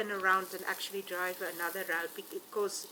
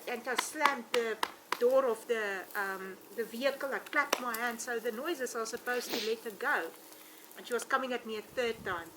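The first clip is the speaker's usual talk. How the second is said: usual speaking voice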